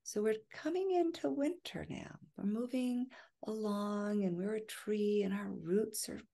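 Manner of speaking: soft, unhurried guided-imagery voice with long pauses; guided meditation. Real, not acted. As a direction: ease listeners into relaxation, phrase by phrase. Continues imagery after long pause.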